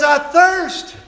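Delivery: loud, high preacher's cry; climactic cry. Acted. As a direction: ring it out to the back row. Jesus' cry climaxing sermon.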